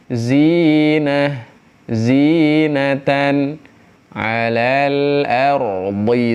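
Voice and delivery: "zinatan alal ardi" drawn out; slow recitation. Shown as a demonstration. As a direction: chant "zinatan" slowly with the long i. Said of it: "dibaca dua harokat".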